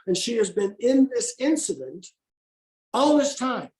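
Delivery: ordinary talk, nothing marked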